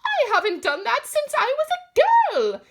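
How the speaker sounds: eager neighbour joining the bee hunt; excited. Acted. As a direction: burst out with delight. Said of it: "Missus Govlock said excitedly".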